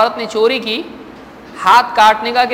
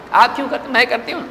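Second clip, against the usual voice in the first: ordinary delivery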